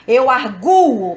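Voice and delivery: "arguo" slow, with a long strong u; stress on U. Shown as a demonstration. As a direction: stretch the gu in "arguo". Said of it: "se a sílaba forte estiver no radical".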